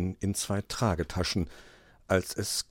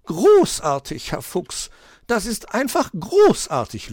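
high, louder, drawn-out praise; praising; 12 semitones higher, 10 dB louder than usual. Acted. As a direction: gush over it as the magpie. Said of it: "lobte sie ihn".

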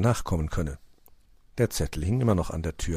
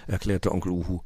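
ordinary delivery

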